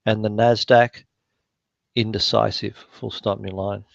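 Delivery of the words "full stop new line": quieter dictation command aside; dictating. Real, not acted. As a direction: drop the voice to dictate punctuation. Dictating punctuation to software.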